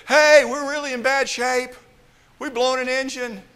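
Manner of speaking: high, loud alarmed announcement; panicked pilot. Pretend. A pilot alarming his passengers, high and loud in panic. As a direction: blurt the bad news in a panic.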